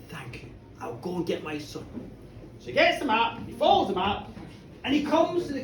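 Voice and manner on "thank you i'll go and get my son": put-on low, soft old man's resolve; quiet gratitude. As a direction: thank her softly, voice dropped. Old man gratefully resolves to go.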